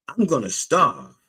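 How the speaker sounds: high-pitched acted worry; mock-anxious hungry man; worried complaint.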